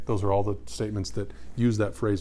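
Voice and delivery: ordinary delivery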